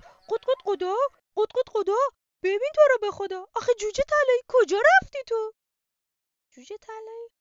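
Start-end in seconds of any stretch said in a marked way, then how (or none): 0.0-5.5 very loud, high clucking mother hen; clucking worried hen. Acted. Mother hen frets over her missing chick, loud high clucking. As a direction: cluck and fret like a frantic hen. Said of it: "خانم مرغ گفت".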